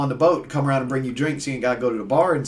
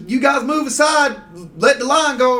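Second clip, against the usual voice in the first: high, raised-voice retold order; calling out; 9 semitones higher, 4 dB louder than usual. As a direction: tell people up ahead to clear the way. Calling out to men blocking steps.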